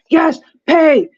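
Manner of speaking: high, excited yes pay; celebrating payday; mock jubilant; cheering. Quoting sailors cheering tax-free pay.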